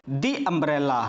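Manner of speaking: "दी umbrella" with long ee in "दी"; THE as "dee". Shown as a demonstration. Teacher giving the answer, "दी umbrella" with a clear dee. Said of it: "तो इसको क्या पढ़ेंगे हम?".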